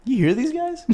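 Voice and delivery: squeaky high disbelief; whisper-ish high aside to a friend; imitating a stunned aside up high; high mock aside. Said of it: "we're like".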